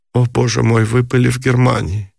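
ordinary delivery

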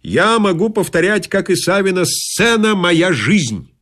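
high-pitched theatrical boast; breezy swagger. A narrator's reading. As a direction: declaim it like a self-styled stage star. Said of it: "дёрнул меня развязно сказать".

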